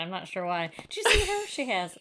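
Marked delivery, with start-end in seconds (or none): 0.9-1.5 high-pitched mock Southern lady; badly faked Southern gossip; terrible Southern accent. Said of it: "I have a terrible southern accent".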